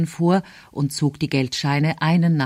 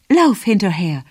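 louder, urging call; called-out order; 2 semitones higher, 6 dB louder than usual. A narrator's reading. Mother sending her child after the donkey, calling out. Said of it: "rief meine Mutter".

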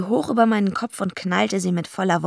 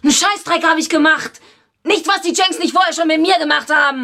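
higher and louder, furious defence; angry outburst; 10 semitones higher, 7 dB louder than usual. Acted. Eva lashes out at Harry's accusation, angrily loud and high. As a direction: snap back hard at the accusation.

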